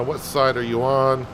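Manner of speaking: normal talking voice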